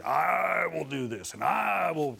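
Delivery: lightly voiced giant taunting Israel; taunting Goliath. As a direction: drag out Goliath's boast, mocking. Preacher voices boasting Goliath.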